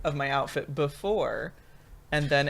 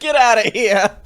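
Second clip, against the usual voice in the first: put-on Italian accent; 4 semitones higher, 9 dB louder than usual. Continuing the Italian bit.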